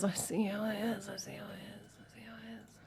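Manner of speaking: fading away into a whisper; mock-hurt muttering.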